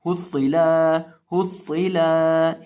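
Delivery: हुस्सिला with the final la dragged long; wrongly lengthened ending. Shown as a demonstration. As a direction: stretch the last vowel of हुस्सिला. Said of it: "लाम को लंबा करके पढ़ देते". ("حُصِّلا" آخری لام کو لمبا کھینچ کر)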